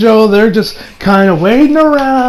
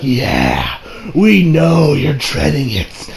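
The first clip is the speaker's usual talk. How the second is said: mock sinister voice; 7 semitones lower, 5 dB quieter, much slower than usual. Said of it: "they're like".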